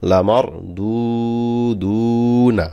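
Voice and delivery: "লামার" short, both "দু" held long, "না" short; unhurried one-alif stretch. Shown as a demonstration. Teacher warning learners not to rush, long-held "দু দু". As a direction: draw out each u in "দু দু", without rushing. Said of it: "তাড়াতাড়ি পড়া যাবে না কিন্তু".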